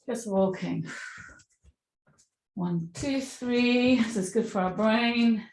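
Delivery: normal talking voice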